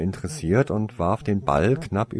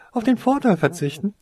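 laughing; 11 semitones higher, 5 dB louder than usual. Acted. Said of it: "lachte Toriesa".

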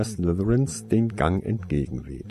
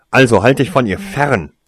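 loud, higher shouted warning; 4 semitones higher, 11 dB louder than usual. Acted. Younger brother yelling at Tarsoin to stay away. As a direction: yell it right in his face. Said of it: "schrie jetzt der Jüngere".

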